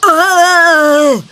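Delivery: a gruff grunting noise from the monster; mock ox grunt. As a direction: grunt like a big ox. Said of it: "and it goes".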